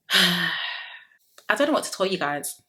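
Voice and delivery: ordinary talk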